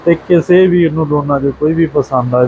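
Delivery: usual speaking voice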